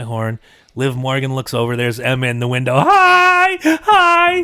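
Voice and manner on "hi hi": high, loud, squealing hi; a thrilled girl waving at her idol; excited kid greeting. Retold or imitated. Daughter greets wrestler from car.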